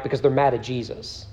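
ordinary delivery

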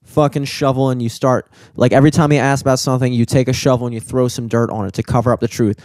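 ordinary delivery

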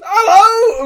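high, loud, beaming call across the hall; big cheerful hello. Retold or imitated. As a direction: greet a fan with delight. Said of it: "beams and goes".

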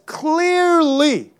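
CLEARLY high and drawn out; emphatic obviousness. Acted. Preacher quoting Galatians as obvious, high drawn-out "Clearly". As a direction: say "Clearly" high, as if utterly self-evident.

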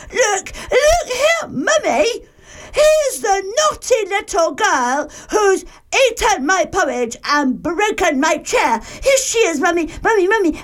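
high, squeaky little bear voice; excited crying Baby Bear. A narrator's reading. Baby Bear finds Goldilocks asleep, high urgent squeal. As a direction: squeal for Mummy like a tiny child. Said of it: "weeny bed and cried".

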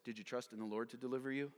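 normal talking voice

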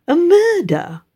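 a sudden high jolt of surprise; startled gasp. A narrator's reading. Wife reading the paper, suddenly startled. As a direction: jump up on the word murder. Reading shock news aloud.

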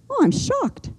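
playfully sarcastic aside to the congregation; mock shock. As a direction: feign being shocked, tongue in cheek.

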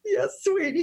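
put-on high, weepy reply to a child; mock tearful. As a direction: answer through put-on sobs. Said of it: "And I start crying".

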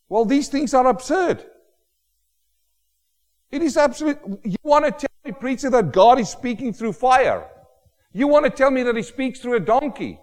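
high, loud scoffing disbelief; mock incredulous objector. Scoffer mocking the preacher, high incredulous voice. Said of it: "they'll think you're crazy".

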